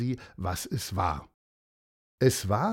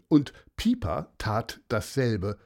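usual speaking voice

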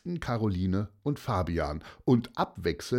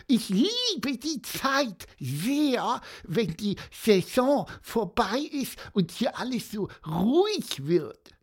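Pieper; 12 semitones higher than usual. A narrator's reading. Little interrupter telling how they love off-season, squeaking. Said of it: "piepste Pieper dazwischen".

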